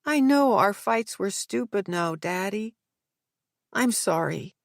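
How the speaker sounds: put-on sleepy, high young daughter; drowsy. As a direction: murmur it half-asleep. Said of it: "A drowsy voice comes from behind them".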